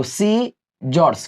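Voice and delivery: "सीजर्स" slowly, in two parts; direct reading, c silent. Shown as a demonstration. Teacher demonstrating to students, "सीजर्स" slow and broken up. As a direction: say "सीजर्स" slowly as see, jors. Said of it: "इसको direct पढ़ेंगे".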